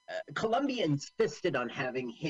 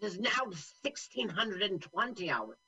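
Terry Thomas; 6 semitones higher than usual. Acted. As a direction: read it out as a pompous comic general. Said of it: "are you doing your Terry Thomas impersonation?".

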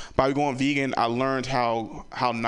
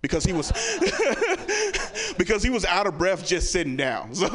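laughing; 7 semitones higher than usual. Real, not acted. Laughing at own joke.

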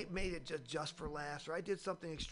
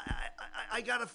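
normal talking voice

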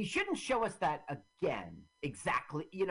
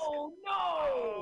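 high wailing "oh no"; mock panic; 11 semitones higher than usual. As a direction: cry out in hammed-up alarm. Mock panic riffing on film.

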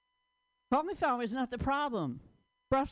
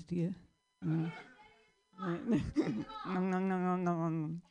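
normal talking voice

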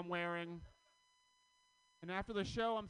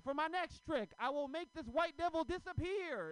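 put-on high, loud showman kid; hammed-up kid magician voice, pitched high; 11 semitones higher, 7 dB louder than usual. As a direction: boom it out like a stage magician announcing his trick.

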